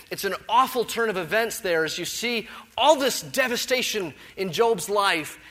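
usual speaking voice

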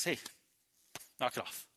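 low, offhand telling-off; casual rebuke. Acted. Jesus casually telling the sea off, casual rebuke. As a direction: say it softly, no effort needed. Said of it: "all he says is".

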